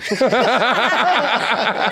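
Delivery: high, loud burst of laughter; real loud laugh. Real, not acted. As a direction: crack up loudly. Laughing at own story.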